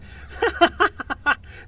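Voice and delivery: high unvoiced wheeze of a laugh; breathy laugh. Keeps laughing.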